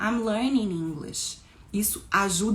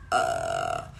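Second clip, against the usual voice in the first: low, creaky groan; drawn-out sickly uhh; miming sickness. Shown as a demonstration. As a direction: groan as if feeling sick. Miming the word sick.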